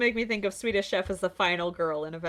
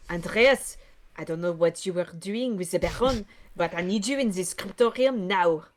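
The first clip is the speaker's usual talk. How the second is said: scolding in a put-on French accent; mildly cross French character. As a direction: summon an underling in French-accented English. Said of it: "this stupid French voice".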